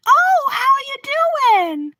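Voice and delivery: put-on very high, slow singsong old lady; imitation of a cooing old neighbor; chirpy elderly neighbor. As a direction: stretch out a high, oblivious grandma greeting. Acts oblivious elderly neighbor greeting.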